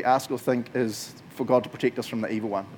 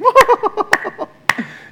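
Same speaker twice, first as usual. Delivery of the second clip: booming laugh; 13 dB louder than usual. Retold or imitated. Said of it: "what I imagine God's response being".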